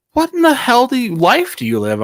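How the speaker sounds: high incredulous question; mock amazement. Coworkers amazed at his lifestyle, mock incredulous.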